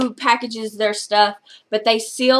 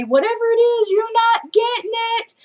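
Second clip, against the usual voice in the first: mock yell; 11 semitones higher than usual. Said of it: "he'd yell down the hallway".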